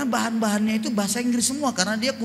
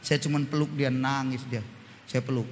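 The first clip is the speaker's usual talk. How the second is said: tender comforting; 10 semitones lower, 6 dB quieter than usual. Retold or imitated. Tender memory of comforting daughter.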